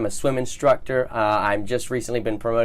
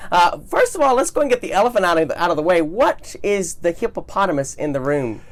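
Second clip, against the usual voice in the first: cruise director; 6 semitones higher than usual. Acted. Host's upbeat hippo question.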